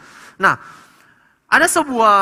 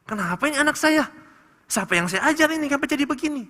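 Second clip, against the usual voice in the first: higher, quick exasperated parent; lightly acted baffled parent; bewildered parent; 7 semitones higher, faster than usual. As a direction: throw up your hands as a puzzled parent, fast. Bewildered parent about child's dream.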